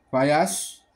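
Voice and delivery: usual speaking voice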